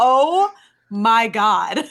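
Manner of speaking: loud, drawn-out gasp of a retold reaction; shocked reaction. Recapping her reaction to a yanked-hair moment, shocked. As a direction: draw out the shock, big and loud. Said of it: "I was like".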